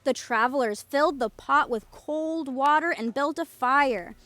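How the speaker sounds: ordinary delivery, nothing marked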